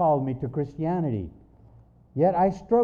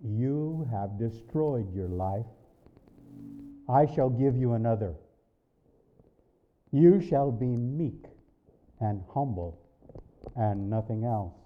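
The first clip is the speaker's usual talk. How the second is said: voice of God; 4 semitones lower than usual. Retold or imitated. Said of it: "Then I heard".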